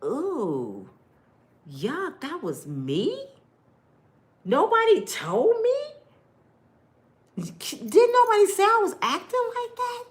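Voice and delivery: high shocked disbelief at herself; appalled at her own behaviour; disgusted disbelief. Retold or imitated. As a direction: recoil at your old self. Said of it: "sitting there like".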